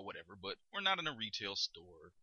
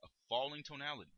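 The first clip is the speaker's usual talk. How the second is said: ordinary delivery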